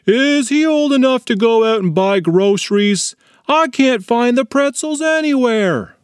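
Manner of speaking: lightly voiced dad calling from another room; hollering dad. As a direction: call out across the house. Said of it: "Nick's dad hollered from the kitchen".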